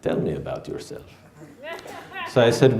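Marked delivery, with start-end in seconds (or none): 0.0-1.1 put-on low, soft, seductive Middle Eastern accent; sexy Middle Eastern accent. Aziza on the phone asking him, low soft Middle Eastern accent. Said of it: "in that sexy Middle Eastern accent".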